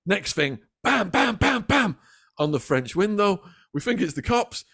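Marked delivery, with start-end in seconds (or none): 0.8-2.0 bam bam knocks, pitched up high; imitated banging. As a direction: mimic urgent knocking on glass.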